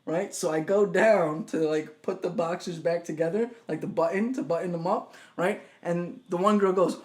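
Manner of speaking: ordinary talk, nothing marked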